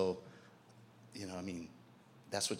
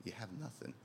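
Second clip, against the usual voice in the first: "You have nothing" dropped much softer; softer, solemn echo of the line; softly repeated; 10 dB quieter than usual. Acted. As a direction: let it fall away to a hush. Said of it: "And Jesus said".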